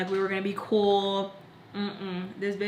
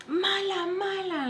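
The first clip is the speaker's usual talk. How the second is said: high-pitched mock tantrum; mad; 11 semitones higher, slower than usual. Friend throwing a fit at the back seat, mad. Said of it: "just, like, mad, okay".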